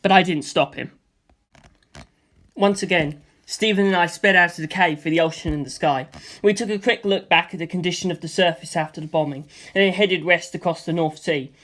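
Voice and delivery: usual speaking voice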